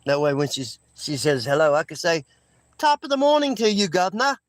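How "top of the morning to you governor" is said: put-on high, playful English accent greeting. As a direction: greet her like a cheery English caricature. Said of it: "English accent, uh, impersonation".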